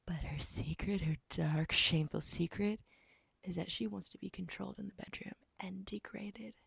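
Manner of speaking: half-whispered scandalous secret; very soft, secretive aside; hushed confiding. Real, not acted. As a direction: drop to a near whisper for the secret. Reveals a shameful secret.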